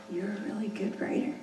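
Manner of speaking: soft, hushed praise leaned in close; like a secret. Retold or imitated. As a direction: tell it like a secret just for him. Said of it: "he leaned in really close".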